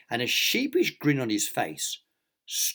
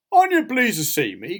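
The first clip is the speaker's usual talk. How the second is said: high, loud, chirpy question; cheerful; 9 semitones higher, 7 dB louder than usual. A narrator's reading. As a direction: ask it with oblivious good cheer. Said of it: "continued Mister Clumsy cheerfully".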